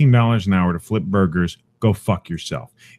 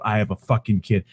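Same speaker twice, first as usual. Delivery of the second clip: ordinary talk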